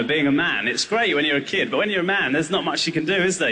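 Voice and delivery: ordinary talk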